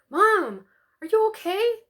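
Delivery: high, loud, worried cry; a daughter calling to her mother in alarm. A narrator's reading. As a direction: rush to check on a choking mom. Daughter alarmed at choking mom.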